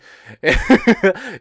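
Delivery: loud, high burst of laughter; real loud laugh mid-story. Real, not acted. Laughs at his own story. (risa fuerte)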